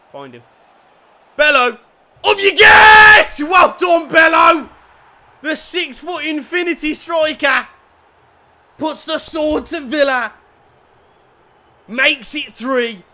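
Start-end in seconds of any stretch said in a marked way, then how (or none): 1.3-4.7 very high, loud, drawn-out roar; goal celebration. Real, not acted. Fan celebrating a headed goal. 5.4-13.0 high, loud mock commentator call; elated fan commentary; excited commentary. Real, not acted.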